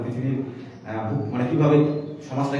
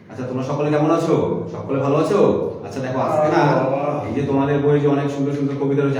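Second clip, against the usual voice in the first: raised, lively teaching voice, louder than conversation; classroom teacher addressing children; 3 semitones higher, 7 dB louder than usual. Pretend. Candidate greets pretend pupils in a demo class, projected teacher voice. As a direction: project to a room of young pupils.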